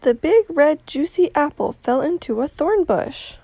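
put-on reading to children. Outdoor reading to children, animated storytelling. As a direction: tell it like a bedtime story.

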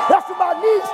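high, loud cry of praise; shouted dedication. Real, not acted. Preacher dedicates praise to his niece, high loud shout. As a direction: shout it out for family.

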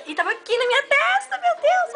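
high, stunned exclamation; shocked disbelief. Real, not acted. Telling viewers the gun was on her forehead, high shocked.

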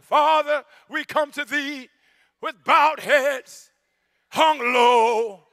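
loud, slow, mock-solemn old-English prayer; stiff formal prayer. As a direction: ham up a pompous thee-and-thou prayer. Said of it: "if you're a Shakespearean thespian".

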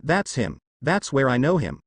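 ordinary delivery

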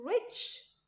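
"ريتش" pitched high, ending in a strong tch; ch sound. Shown as a demonstration. Teacher modelling a sound for pupils, "ريتش" with sharp final tch. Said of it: "ش ساوند".

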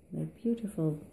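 ordinary talk, nothing marked

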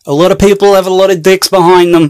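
loud, pushed video voice; shouted presenter patter; overloud. Acted. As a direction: blast it out far too loud. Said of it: "turn your fucking audio down".

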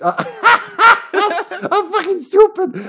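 high squeaky words through laughter; cracking up at yourself; laughing hard. Real, not acted. Laughing at own mistake.

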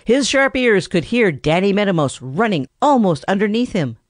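ordinary delivery, nothing marked

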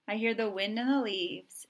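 usual speaking voice

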